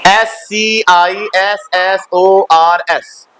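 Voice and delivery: slow, evenly spaced letter names; spelling out letters. Shown as a demonstration. Teacher reads the painted word to class, spelling it out slowly. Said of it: "वहां पे लिखा हुआ था".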